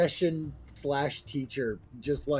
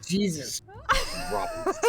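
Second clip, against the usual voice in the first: shocked exclamation; slower than usual. Real, not acted.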